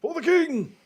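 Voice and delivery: very high-pitched echoed cheer; mock battle cry. Co-host echoes the game's title in a high mock battle cry. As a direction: call it back way up high.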